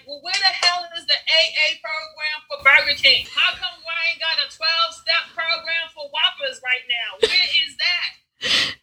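hammed-up fury at Burger King; mock angry rant. Retelling his rant in the car at the fast food lot, mock outraged. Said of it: "screaming and cursing like".

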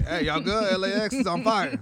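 loud, urgent check-in, half laughing at it; mock worried caller. Friend phoning to check on them about a fire, loud mock alarm. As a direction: raise the voice like a caller with big news. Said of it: "He probably called about the same thing".

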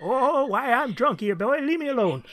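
put-on high, cackly old drunk voice; Walter Brennan impression. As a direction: slur it like a drunk old coot in a jail cell. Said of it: "I broke out my Walter Brennan impersonation".

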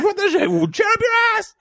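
very high panicked shout; girl freaking out. Retold or imitated. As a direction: scream it in panic. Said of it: "the girl freaking out".

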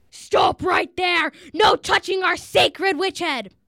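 slow, high exasperated shout; exasperated innkeeper yelling a warning; loud, exasperated. A narrator's reading. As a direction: shout it in outrage, stretching each word. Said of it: "loud, exasperated voice that said".

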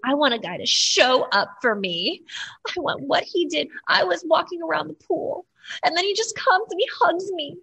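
rising into a high, overwrought gush; dramatic Claire. Retold or imitated. Co-host mimicking how she talks on the show, dramatic. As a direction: play it up like a lovestruck drama queen. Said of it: "she's so dramatic".

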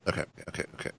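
composing himself. Real, not acted. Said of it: "Let me compose myself here".

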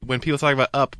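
ordinary delivery

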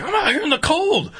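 high complaining shout; irritated dealer. Retold or imitated. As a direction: whine it angrily. Dealer complaining, continuing outrage.

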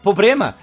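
loud "pobrema", l turned into r; wrong pronunciation. Shown as a demonstration. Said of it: "mais difícil ainda falar errado".

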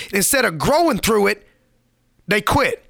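ordinary talk

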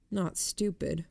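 ordinary delivery, nothing marked

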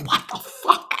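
laughing breathy whisper; amused whispered what the fuck; whispered laughing disbelief. Real, not acted. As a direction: giggle it under your breath. Laughing at her own story.

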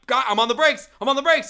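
high, loud frantic exclamation; acted-out panicky shout; retold panic. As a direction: insist in alarm that you're braking. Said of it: "I'm like".

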